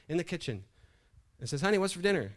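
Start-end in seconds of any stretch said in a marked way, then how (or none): 1.6-2.3 loud, high call to another room. Acted. Husband to wife in the kitchen, calling out. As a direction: call out to someone out of earshot. Husband calls from right behind her.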